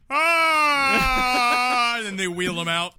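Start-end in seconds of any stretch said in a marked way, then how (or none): 0.1-2.0 mock scream. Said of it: "he just goes like".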